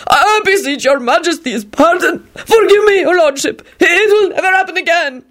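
very high, pleading goblin cries; frantic wail. A narrator's reading. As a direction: beg for your life in a squeal. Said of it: "he wailed frantically".

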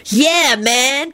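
high, delighted dude voice; happy. A narrator's reading. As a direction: agree with glee. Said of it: "Kenny replied happily".

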